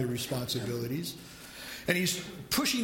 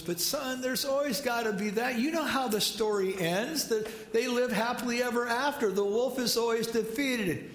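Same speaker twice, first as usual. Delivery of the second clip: higher, gently persuading father; coaxing dad; 4 semitones higher than usual. Retold or imitated. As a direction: coax the child like a patient dad. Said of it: "He goes".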